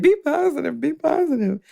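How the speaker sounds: mildly mock-cheerful reminder to stay upbeat; sing-song self-quote. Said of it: "I'm always saying".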